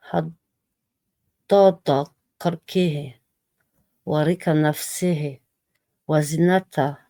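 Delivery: slow, measured Arabic invocation; prayer recitation. Acted. Reciting a dhikr for viewers, slow devout recitation. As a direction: recite each phrase with care, drawn out.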